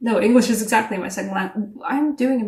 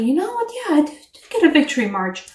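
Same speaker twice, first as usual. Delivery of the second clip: mock proud boast; 5 semitones higher than usual. Voicing the listener's proud self-talk.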